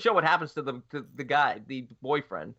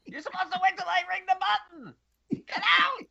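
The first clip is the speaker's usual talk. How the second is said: high shrieking angry little man; mean little guy yelling; 13 semitones higher than usual. Retold or imitated. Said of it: "how mean the little guy was".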